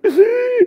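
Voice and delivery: loud, high-pitched mock wail; hammed-up crying squeal; imitated crying. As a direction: burst into an exaggerated squealing sob. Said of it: "And I cried".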